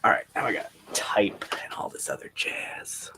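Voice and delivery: soft whisper while typing. Real, not acted. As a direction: whisper to yourself, unvoiced. Typing quietly during sprint.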